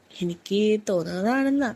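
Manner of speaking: usual speaking voice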